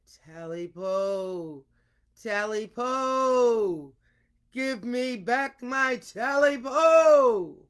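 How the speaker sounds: slow, drawn-out, building to a loud shout; frightening booming call. Shown as a demonstration. Storyteller showing how to make the ghost's call frightening, slow building shout. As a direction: boom it out slowly like a ghost demanding its due. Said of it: "depends on the teller's voice for its frightening effect".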